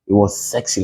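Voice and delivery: normal talking voice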